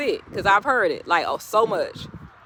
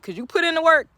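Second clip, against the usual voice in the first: pitched way up, rising joy; excited high exclaim; 9 semitones higher, 3 dB louder than usual. Acted. To hardworking viewers, a high excited praise. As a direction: lift it up like a shout of praise. Preaching climax.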